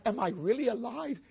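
high, rising, breathless wonder; as if pinching yourself awake; stunned disbelief. Acted. Preacher voicing Peter's disbelief watching Lazarus rise. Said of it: "he starts biting like".

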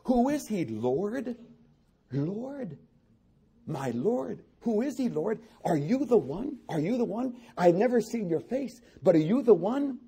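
high, longing plea to the Lord; healed man searching for Jesus's face, pleading; pleading blind man. Acted. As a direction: beg to know if he is the one.

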